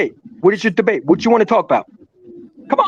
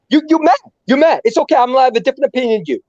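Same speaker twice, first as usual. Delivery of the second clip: usual speaking voice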